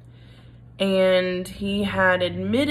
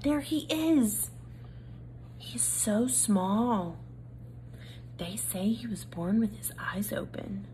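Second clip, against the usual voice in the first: hushed gossip; 8 dB quieter than usual. A narrator's reading. Mice gossip about Despero in hushed voices. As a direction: lean in and gossip softly.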